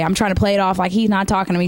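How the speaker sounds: ordinary delivery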